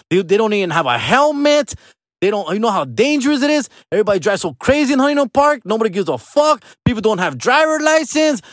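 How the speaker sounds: high-pitched mock tirade, words pouring out indignantly; irate mom. Mom berating dad over the kids by phone, high mock-angry rant. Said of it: "I get that fucking phone call".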